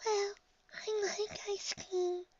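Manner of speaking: timid high, soft, slow voice; Fluttershy. A narrator's reading. Shy pony tempted to taste snow, timid and soft. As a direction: muse shyly in a tiny voice. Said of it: "says Fluttershy".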